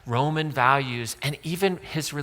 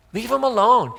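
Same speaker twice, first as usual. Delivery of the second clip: higher and louder, firm; emphatic repeat; 7 semitones higher, 5 dB louder than usual. Pretend. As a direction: Leave 'em alone, pushed higher and louder. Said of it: "This is Jesus".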